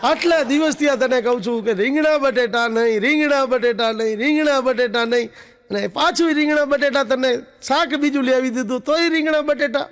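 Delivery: rant, repeating the dish name in fury; mock furious yell. Husband blowing up at wife over brinjal again, mock furious. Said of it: "ભાઈનો મગજ ગયો".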